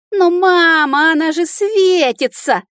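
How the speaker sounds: high, slow, drawn-out whine; loud childish wail, stretched out; shrieking child. A narrator's reading. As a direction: squeal in protest like a little dragon. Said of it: "верещало дитя".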